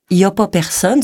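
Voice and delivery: colloquial Quebec French, broad rounded vowels. Shown as a demonstration. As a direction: say it in everyday Québécois. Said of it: "On dit". (accent québécois familier)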